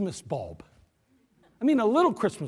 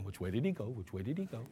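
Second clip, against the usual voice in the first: very low, soft cartoon voice; cartoon quote; 10 semitones lower, 13 dB quieter than usual. Driver quoting a cartoon while lost, low and soft. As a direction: mumble it like the cartoon character. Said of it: "I feel like that cartoon".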